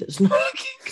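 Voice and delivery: laughing. Acted. Repeating a friend's verdict, laughing. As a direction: giggle the words out.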